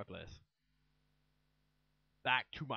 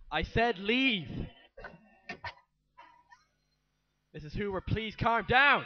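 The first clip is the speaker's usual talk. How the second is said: yelling; 6 semitones higher, 9 dB louder than usual. Acted. Mother yelling, nurse shouting.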